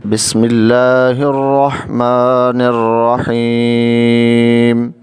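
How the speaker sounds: slow, drawn-out tajweed recitation; Quranic recitation. Shown as a demonstration. Teacher opening the lesson with a slow recitation. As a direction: recite it slowly and melodically. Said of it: "آئیے ہم شروع کرتے ہیں۔".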